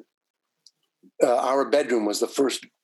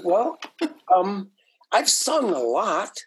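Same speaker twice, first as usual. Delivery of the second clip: hesitant hedging; 8 semitones higher, slower than usual. Retold or imitated. Hedging answer to "Can you sing?.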